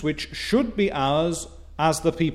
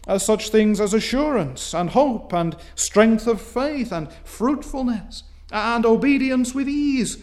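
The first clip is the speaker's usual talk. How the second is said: fervent preaching; 7 semitones higher, 3 dB louder than usual. Acted. Preaching build, raised.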